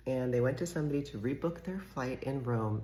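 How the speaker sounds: ordinary delivery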